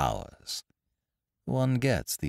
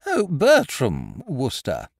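slow, bright and higher lady's voice; bright Miss Tomlinson; 7 semitones higher, 5 dB louder, slower than usual. A narrator's reading. Headmistress brightly recalling the name, in a slow, higher voice. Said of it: "nodded brightly".

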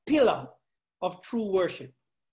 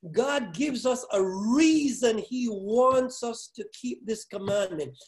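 ordinary talk

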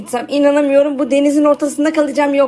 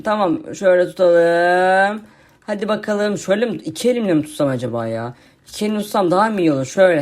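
lightly lowered, lazy zombie voice; zombie friend voice; 6 semitones lower than usual. Acted. YouTuber voicing his zombie friend for viewers, lightly lowered zombie voice.

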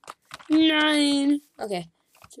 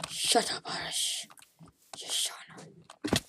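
hissed whisper telling him to be quiet; whispered shush; 12 dB quieter, slower than usual. Real, not acted. Whispering to Arash to shut up. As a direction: whisper sharply so nobody else hears. Telling someone off mid-game.

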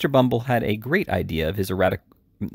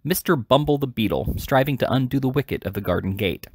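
ordinary delivery

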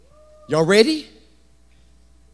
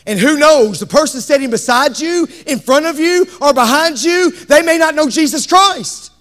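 high, pressing pulpit appeal; raised, fervent sermon voice; urgent preaching; 7 semitones higher, 7 dB louder than usual. Real, not acted. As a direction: press the warning on the congregation.

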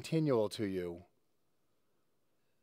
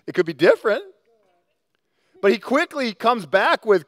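ordinary talk

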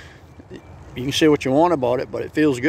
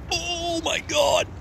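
mouse's dismay; much slower than usual. Acted. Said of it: "He's like".

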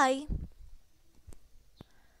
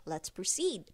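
ordinary delivery

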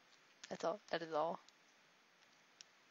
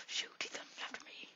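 much softer alarmed whisper; 9 dB quieter than usual. Real, not acted. Gamer noticing an enemy chasing him, alarmed whisper. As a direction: whisper the warning.

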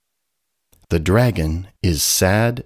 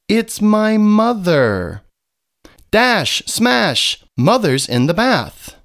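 1 surprised call; 11 semitones higher, 3 dB louder than usual. A narrator's reading. Dolphin surprised at mother.

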